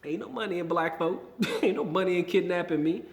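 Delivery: ordinary delivery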